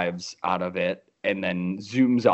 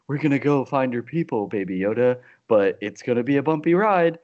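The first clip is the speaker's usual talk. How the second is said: lightly voiced Mando, a touch higher; Mando's cadence; 5 semitones higher, 4 dB louder than usual. Host quoting Mando's bumpy-ride line to cohost, lightly voiced. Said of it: "in that same cadence as well".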